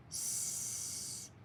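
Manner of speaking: voiceless "S" with no vowel after it; isolated S sound. Shown as a demonstration. Teacher isolates the S phoneme for students. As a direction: hiss out "S" as a bare sound. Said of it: "Only three phonemes here".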